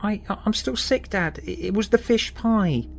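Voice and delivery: put-on high, weak voice of a sick child; young boy's weak pleading voice. A narrator's reading. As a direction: plead feebly like an ill boy. Sick boy pleads with dad.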